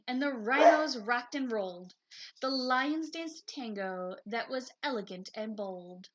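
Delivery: lively storybook narration; reading to children. A narrator's reading. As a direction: read it like a bedtime picture book. Reads picture book aloud.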